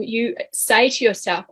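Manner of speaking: normal talking voice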